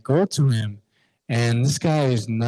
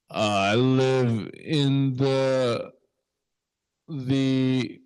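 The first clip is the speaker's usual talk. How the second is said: slow, halting drunk imitation; drunk man; much slower than usual. Drunk stranger trying to say where he lives, slurry hesitation. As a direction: drag the words out, searching for them.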